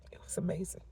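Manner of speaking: hushed, breathy whisper of awe. Real, not acted. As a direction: whisper it in wonder.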